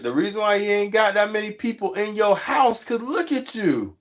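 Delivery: high, mocking put-down; teasing roast. Vlogger roasting a streamer with few viewers, high and teasing. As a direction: roast them lightly. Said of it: "I'm like".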